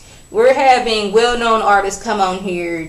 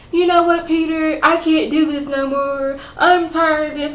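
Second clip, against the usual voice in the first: higher-pitched, mildly fed-up imitation; retold exasperation; 6 semitones higher than usual. Tyra telling Peter she's done, mock exasperation. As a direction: imitate her announcing she has had enough. Retelling her fed-up outburst.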